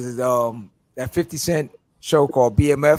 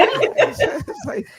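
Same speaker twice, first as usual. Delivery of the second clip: laughing. Real, not acted.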